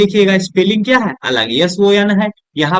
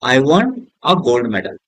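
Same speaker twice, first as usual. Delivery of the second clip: very slow, word by word, for the class to copy; slow example sentence; 4 semitones lower, much slower than usual. Shown as a demonstration. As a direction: dictate the sentence slowly. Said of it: "I won मतलब क्या होता है". (धीरे-धीरे उदाहरण वाक्य)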